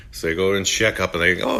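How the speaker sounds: normal talking voice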